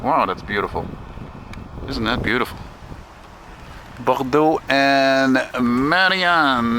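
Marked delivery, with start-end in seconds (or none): 4.0-6.8 "Bordeaux and Marianne" slow, announced; "Marianne" stretched with a long fall; drawn-out street names. Real, not acted. Announcing street corner slowly.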